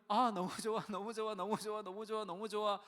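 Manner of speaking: loud, high, gushing delight; mock gushing praise. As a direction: ham up the members' empty raving. Mocking big-church members' praise.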